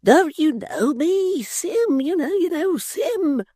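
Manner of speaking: pleading to be recognised; Tappertit's voice. Acted. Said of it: "cried Mister Tappertit".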